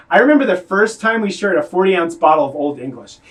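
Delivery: normal talking voice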